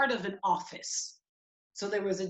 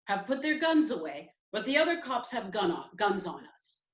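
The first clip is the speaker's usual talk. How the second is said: ordinary talk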